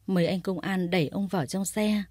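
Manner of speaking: usual speaking voice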